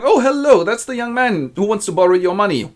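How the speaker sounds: high, lively greeting; breezy introduction. Acted. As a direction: pitch up brightly for an introduction. Agent introduces the borrower.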